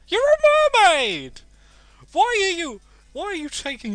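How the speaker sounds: shrieked high and loud; squealing shock at a mermaid; outraged disbelief. Real, not acted. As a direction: blurt out in disbelief.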